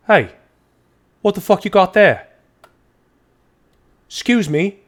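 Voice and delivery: loud, high, indignant accusing shout; angry aristocrat. Acted. Pompous dwarf aristocrat catches a thief, furious shout. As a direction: bark the accusation at the thief, outraged.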